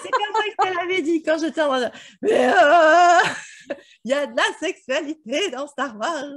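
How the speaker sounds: loud and high, half laughing; gleeful loud retelling; excited. Real, not acted. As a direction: blurt it out excitedly.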